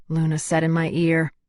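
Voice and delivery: ordinary delivery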